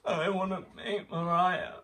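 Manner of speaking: put-on a child begging to meet her idol, high pitched; young child. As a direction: beg like a small upset child. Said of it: "And I'm like".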